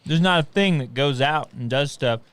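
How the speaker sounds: usual speaking voice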